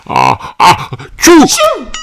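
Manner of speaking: building ah-ah then high loud achoo; comic cartoon sneeze; big sneeze. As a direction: wind up to a huge sneeze.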